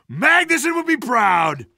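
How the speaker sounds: ordinary talk, nothing marked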